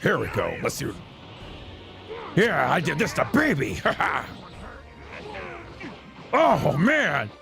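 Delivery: lightly voiced cocky fighter, chuckling between remarks; lightly put-on hero voice; in-character anime hero. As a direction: play the Super Saiyan hero enjoying his own moves. Plays the game speaking as its fighter.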